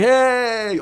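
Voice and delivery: high excited "Hey!" on entering; loud cheerful hello to the group; loud party greeting. Shown as a demonstration. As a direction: burst in with a big hey. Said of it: "I'll be like".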